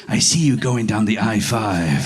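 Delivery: low fortune-teller pronouncement. Acted. Fortune teller seeing a freeway in someone's future, low mystic voice. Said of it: "like, a fortune teller just being like".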